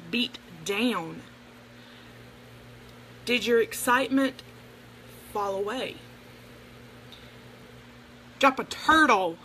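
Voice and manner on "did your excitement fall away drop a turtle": ordinary talk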